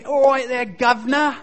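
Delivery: hammed-up Cockney impersonation, loud and high; mock English accent. Student showing off an English impersonation, mock Cockney accent. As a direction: put on a bad English accent. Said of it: "really good English accent".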